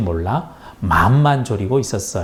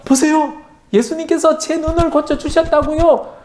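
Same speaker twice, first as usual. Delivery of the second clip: much higher, thrilled amazed voice; overjoyed; 14 semitones higher than usual. A narrator's reading. Blind man seeing for the first time, much higher joyful cry. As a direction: cry out in joy that you can see.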